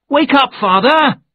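put-on high, loud child's call to wake her father; excited young voice shouting up at him. A narrator's reading. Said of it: "someone called".

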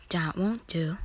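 understated serious disapproval. A narrator's reading. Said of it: "to see his serious face".

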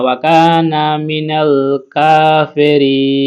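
slow chanted tilawat; Quran recitation. Acted. Teacher closes the verse in slow melodic recitation. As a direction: draw out কাফিরিন melodically.

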